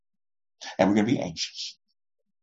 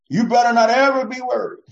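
high, loud scolding voice; mock stern rebuke; 10 semitones higher, 6 dB louder than usual. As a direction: bark it like a harsh scold.

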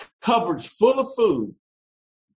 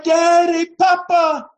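high, loud, heartfelt cry to a father; crying out Daddy; 11 semitones higher, 7 dB louder, slower than usual. Retold or imitated. Preacher shows a child crying out to God, loud and high. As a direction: call out like a child reaching for his dad. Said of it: "No, we cry".

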